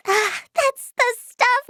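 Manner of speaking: high, slow contented sigh; blissful relief. Acted. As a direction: melt into the first sip. Relief after first sip of coffee.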